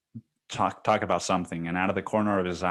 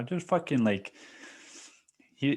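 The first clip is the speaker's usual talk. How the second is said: ordinary delivery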